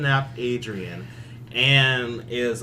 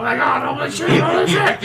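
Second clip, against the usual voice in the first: mock Dustin panic; 11 semitones higher, 7 dB louder than usual. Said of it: "Dustin's like".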